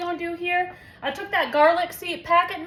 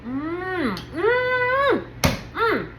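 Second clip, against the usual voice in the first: rising mmm of delight on the first bite; tasting hum; 4 semitones higher, much slower than usual. Real, not acted. Host tasting her casserole, delighted hum. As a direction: savour it with a long hum.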